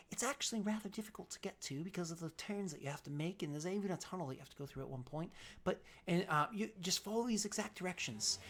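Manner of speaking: soft, confidential contact voice; wary informant. Acted. Game master plays informant giving directions.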